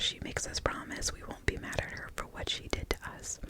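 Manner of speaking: ordinary delivery, nothing marked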